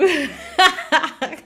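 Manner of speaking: loud, high burst of laughter; tickled laugh. Real, not acted. Host amused by her own raspy showing off, loud laugh. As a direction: crack up loudly at yourself.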